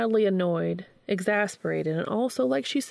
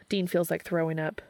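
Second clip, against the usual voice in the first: plain reading voice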